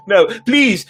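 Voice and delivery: high, loud frightened begging. A narrator's reading. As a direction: Noah begs his masked sister to stop, high frightened begging. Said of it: "Noah begged".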